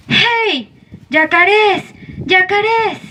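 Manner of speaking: high, carrying call across the water. Acted. Men on a raft hail the caimans, calling out.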